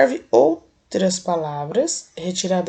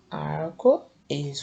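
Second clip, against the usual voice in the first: drawn-out word; 4 semitones lower, 4 dB quieter, much slower than usual. Shown as a demonstration. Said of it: "observando o formato arco-íris".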